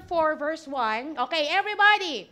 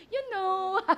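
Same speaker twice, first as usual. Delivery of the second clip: joking, raised "you know"; mock English speaker; 3 semitones higher than usual. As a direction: parody someone with one English phrase. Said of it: "Yun lang ang alam na English".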